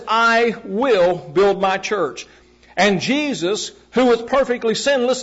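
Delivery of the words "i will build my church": very slow, will weighted heavily; stress on WILL. Shown as a demonstration. Preacher contrasting will with may, stressing will. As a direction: lean on will, no doubt about it. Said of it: "but he says".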